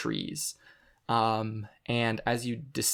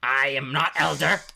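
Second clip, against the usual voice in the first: offended creature voice; 3 semitones higher, 8 dB louder than usual. Acted. Creature rebukes the greeting.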